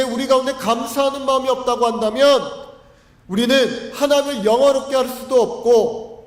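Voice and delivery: impassioned pulpit exhortation; fervent preaching. Acted. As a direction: preach it out with conviction. Preaching at full force.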